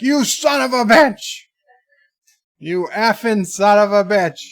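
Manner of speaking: high, loud furious yell; imitating mom screaming at the garbage man; angry shout. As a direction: explode at the ignoring garbage man. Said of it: "finally she goes off".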